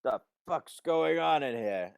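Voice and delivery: high, loud, drawn-out mock holler; voice from offstage. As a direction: call out slowly like a startled guard. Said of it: "you hear a faint voice go".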